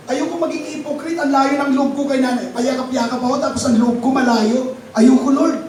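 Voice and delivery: high, insistent refusal; refusing. Retold or imitated. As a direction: dig in and refuse the Lord. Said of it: "I refuse again". (matigas na pagtanggi, mataas ang boses)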